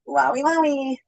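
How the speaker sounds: ordinary delivery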